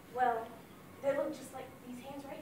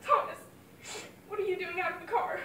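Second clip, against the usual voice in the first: startled scolding; 6 semitones higher, 5 dB louder than usual. Acted. Mother spots her son out of the car.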